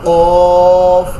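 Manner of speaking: কফ said loud from deep in the throat; deep-throat qaf. Shown as a demonstration. Teacher showing students the throat letter qaf, loud. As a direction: punch কফ from the root of the throat. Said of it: "এটাও সেই গলা থেকে".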